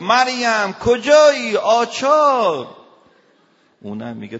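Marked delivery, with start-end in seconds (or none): 0.0-2.8 loud, high call, the last word stretched out very slowly; husband hollering at Maryam for the wrench; yelling for his wife. Retold or imitated. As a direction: bellow across the house for the wrench.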